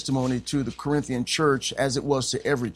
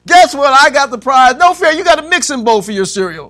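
loud, high triumphant telling; preacher bragging he got the prize, loud; excited; 11 semitones higher, 13 dB louder than usual. Acted. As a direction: shout the punchline of the prize. Excited story climax.

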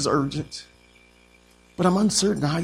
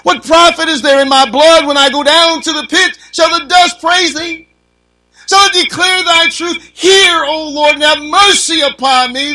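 high and loud, near crying on the complaints; preaching at full force; 9 semitones higher, 10 dB louder than usual. Acted. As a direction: wail David's hurt to the Lord. Preacher reads psalm aloud.

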